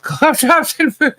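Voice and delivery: words squeezed out through laughter; laughing. Real, not acted. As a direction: laugh it out slowly. Laughing while teasing.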